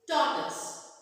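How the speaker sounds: "tortoise" as TOR-tus, first syllable hit; correct pronunciation. Shown as a demonstration. Teacher gives the word, "tortoise" as TOR-tus. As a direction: model "tortoise" with a short tus ending. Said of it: "The word is".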